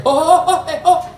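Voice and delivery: high-pitched hooting from afar; excited high hoots; teasing janitor. Retold or imitated. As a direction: imitate João's squeaky yelps. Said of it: "And I hear".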